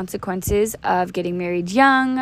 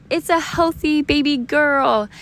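high, drawn-out happy announcement; birth announcement; 8 semitones higher than usual. Retold or imitated. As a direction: announce the newborn in a lifted, slow voice. Delivery room announcement of the birth.

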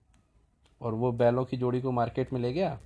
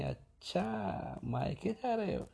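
sly whisper; 7 semitones higher, 6 dB quieter, much slower than usual. Retold or imitated. Servant overhears plan.

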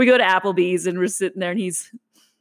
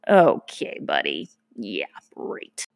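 sarcastic; 10 semitones lower, much slower than usual. Real, not acted. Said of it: "sarcastic aside".

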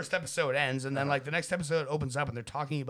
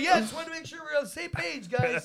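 higher, mock polite voice; imitating John; 9 semitones higher than usual. John checking the crew is on board, mock polite. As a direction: play it like a fussy artist. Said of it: "He's like".

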